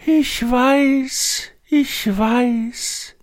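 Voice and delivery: put-on high, sighing old tree voice. A narrator's reading. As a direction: sigh it out as a weary tree. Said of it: "seufzte der andere Baum".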